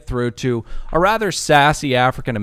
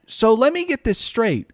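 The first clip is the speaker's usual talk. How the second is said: incredulous; 5 semitones higher than usual. Retold or imitated. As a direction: lay out the logic point by point, exasperated. Confronting the clerk.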